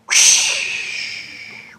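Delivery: slow, airy wind-like reading; slow whooshing narration; windy whoosh. A narrator's reading. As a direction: blow the words out like wind. Wind sound in story.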